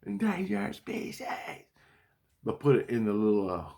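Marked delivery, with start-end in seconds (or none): 0.0-1.2 high, far-off alarm call, lightly done; imitated kids' alarm. Recorded kids' voices as dream alarm, high alarm call. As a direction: echo it like a recorded child's scream. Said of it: "they'll record my kids".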